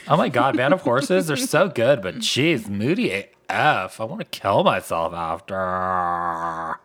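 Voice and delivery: put-on loud, airheaded girl voice; vapid girl voice. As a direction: play a shallow valley girl. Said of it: "That's my vapid girl voice".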